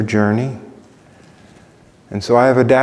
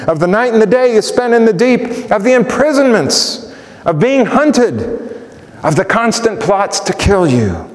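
impassioned, higher preaching cadence; full-force preaching; 9 semitones higher, 4 dB louder than usual. Acted. Preacher pressing Paul from the pulpit, impassioned preaching.

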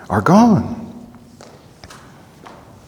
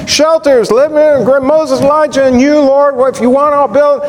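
high and a bit louder, bubbling over; excited Peter; 10 semitones higher, 3 dB louder than usual. Retold or imitated. Peter interrupting to offer to build shelters, excited. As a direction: blurt it out like an overeager disciple. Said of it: "What was he speaking about?".

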